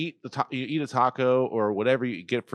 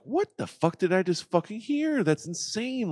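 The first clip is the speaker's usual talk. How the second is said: higher, slowed disbelief; higher and slower, stunned; 9 semitones higher, slower than usual. Acted. Eavesdropper stunned.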